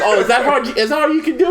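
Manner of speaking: high, taunting mock challenge; sneering playful dare. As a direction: tease him like he's weak. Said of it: "I was like".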